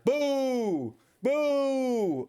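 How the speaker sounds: high, drawn-out mock boo. Streamer leads chat against one chatter, playful booing. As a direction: jeer with long high boos. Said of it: "Everyone boo the chatter".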